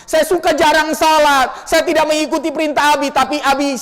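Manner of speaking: put-on high, earnest child's apology; child pleading. Child apologizing at father's grave, high and pleading. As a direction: plead tearfully to a dead father. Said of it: "anaknya ngomong".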